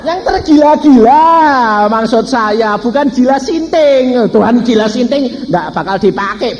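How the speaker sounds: high-pitched, emphatic sermon voice; impassioned preaching. Real, not acted.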